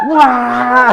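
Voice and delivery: loud, high, drawn-out "waah"; leaping yell. Retold or imitated. Helper jumping from a tree to scare, yelling. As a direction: roar out as if pouncing from above. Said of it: "소리 지르면서 막 뛰어내리는".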